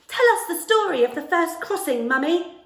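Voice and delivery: put-on high loud pleading hedgehog children; pleading little hedgehogs. A narrator's reading. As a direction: beg excitedly like little ones at bedtime. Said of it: "little hedgehogs would plead".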